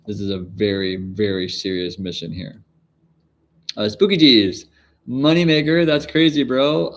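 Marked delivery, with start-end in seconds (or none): 3.8-6.9 excited. Real, not acted. Reacting to a big chat donation.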